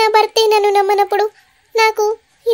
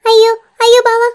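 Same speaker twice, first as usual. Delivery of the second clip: frightened plea; 3 semitones higher, 4 dB louder than usual. Acted. Wife begs her husband, frightened. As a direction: beg him in fright.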